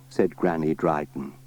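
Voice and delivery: ordinary delivery, nothing marked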